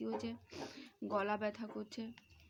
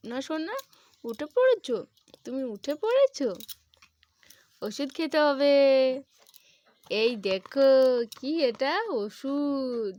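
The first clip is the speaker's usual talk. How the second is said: higher, much louder sing-song coaxing; 3 semitones higher, 16 dB louder, slower than usual. Real, not acted. Mother to her sick son: loud, high sing-song coaxing. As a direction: coax the little one to take medicine.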